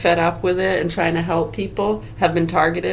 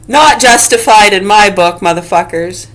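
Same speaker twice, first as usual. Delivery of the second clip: NOT shouted out much louder; shouted verdict; 11 dB louder than usual. Real, not acted. Ranting at wrongdoers, shouting not justified. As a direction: yell it as a final ruling. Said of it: "no matter what you say".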